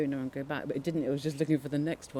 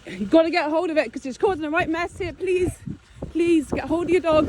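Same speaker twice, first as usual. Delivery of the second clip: much higher, louder pleading shout; desperate shouting; 9 dB louder than usual. Real, not acted. [name] shouting to the dog owner to grab it, desperate. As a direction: yell it across the field, begging.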